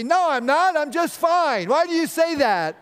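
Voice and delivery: loud, high-pitched defensive protest; hammed-up indignant outburst; angry denial. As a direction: deny being angry while obviously shouting it. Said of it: "you seem to be angry".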